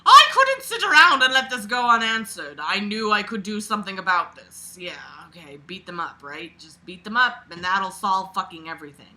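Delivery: ordinary talk, nothing marked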